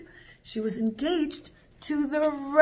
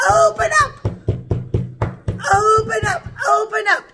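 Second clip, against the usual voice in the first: police shout; 10 semitones higher, 10 dB louder than usual. Acted. Russian police banging on the rabbi's door at night, shouting.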